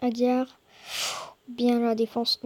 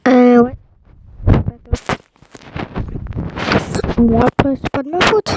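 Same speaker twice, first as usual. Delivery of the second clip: loud half-joking protest; 13 dB louder than usual. Real, not acted. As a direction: blurt it out loud in defence. Loud outburst about bad match.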